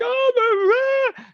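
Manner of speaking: high, loud, horrified cry; mock panic. Losing trader sees puts explode, high horrified cry. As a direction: wail like a trader losing everything. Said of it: "and you're like".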